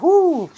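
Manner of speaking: loud high whoo from paint fumes; fume-struck whoo. Real, not acted. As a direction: let out a sharp high whoo. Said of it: "I can smell the spray paint".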